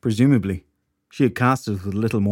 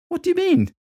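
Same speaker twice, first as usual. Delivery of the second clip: put-on young woman voice. Shelley voiced, high.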